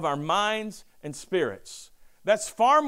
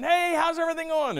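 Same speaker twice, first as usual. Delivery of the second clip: high bouncy hello; mock cheerful greeting; 9 semitones higher than usual. Pastor mimicking upbeat people greeting Eeyore. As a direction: pitch it up like someone bouncing around. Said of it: "jumping around saying".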